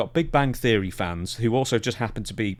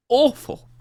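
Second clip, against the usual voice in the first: disgusted; 14 semitones higher, 5 dB louder than usual. Real, not acted. Exclaimed high and loud.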